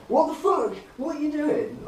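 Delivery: high, startled retold protest; mock-outraged, pitched well up; indignant outburst. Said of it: "And I'm like".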